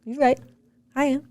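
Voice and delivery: soft, easy admission, like a husband owning it; gently concede, no fight; calm agreement. Retold or imitated. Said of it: "He's gonna be like".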